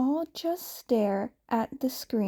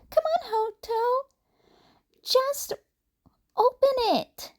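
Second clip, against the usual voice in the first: put-on much higher, bright kid voice for Izuku; excited little boy, pitched way up; high child voice; 13 semitones higher than usual. A narrator's reading. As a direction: voice the young boy high and eager. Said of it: "Izuku said".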